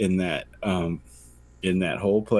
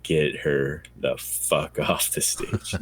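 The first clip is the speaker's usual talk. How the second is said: mock crowd grumble; 5 semitones lower, 5 dB quieter, slower than usual. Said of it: "they're just like".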